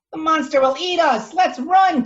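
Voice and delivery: put-on very high, loud child's panic; frightened declaration. Little Toast panics, very high and loud. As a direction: urge them to run. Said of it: "Toast declared".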